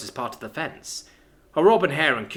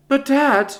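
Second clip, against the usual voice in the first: whiny protest; 13 semitones higher, 8 dB louder than usual. Acted. Daughter protests.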